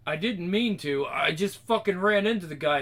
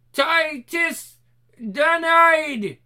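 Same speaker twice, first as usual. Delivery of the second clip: high, loud reversed gibberish; backwards talking; 10 semitones higher, 6 dB louder, much slower than usual. Acted. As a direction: bark it out like a reversed tape. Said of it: "doing the backwards talking".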